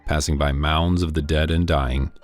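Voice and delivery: ordinary delivery, nothing marked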